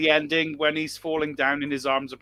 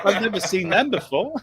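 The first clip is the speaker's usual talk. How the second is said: laughing; 8 semitones higher, 5 dB louder than usual. Retold or imitated. Voicing naive child, laughing.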